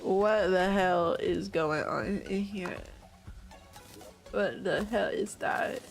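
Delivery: normal talking voice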